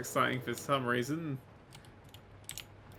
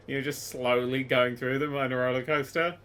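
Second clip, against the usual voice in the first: normal talking voice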